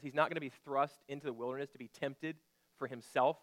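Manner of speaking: ordinary talk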